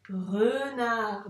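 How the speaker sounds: renard with both vowels stretched; example word. Shown as a demonstration. Teacher giving an example for the E sound, renard said slowly stretched. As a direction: draw out the "re" and "nard" of renard. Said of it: "comme dans".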